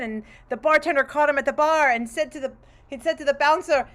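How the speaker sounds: ordinary delivery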